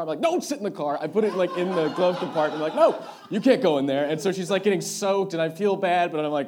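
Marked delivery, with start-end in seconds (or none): 0.2-1.0 loud, high mock yell; panicked refusal. Boyfriend keeping her from the hidden ring, panicked refusal. 2.7-4.1 loud, high mock-panic yell; panicked shout. Boyfriend guarding the glove compartment, panicked shout. Panicked refusal, hiding the ring.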